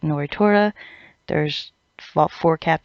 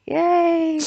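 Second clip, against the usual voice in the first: much higher mock cheer; sarcastic yay; 13 semitones higher than usual. Mocking yet another Zaraki win with a much higher fake cheer.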